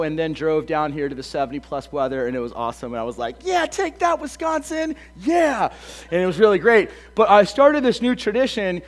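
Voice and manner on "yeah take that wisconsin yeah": mock gloating. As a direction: crow it like a playful victory cheer. Mock gloating at colder home state.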